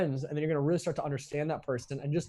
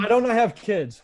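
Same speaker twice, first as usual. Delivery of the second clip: mock mishearing; 7 semitones higher, 8 dB louder than usual. Joking mishearing, loud.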